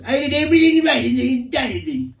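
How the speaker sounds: high, loud mock Trump whine; hammed-up Trump defense; Trump impression. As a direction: protest innocence like Trump. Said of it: "I'm paraphrasing here".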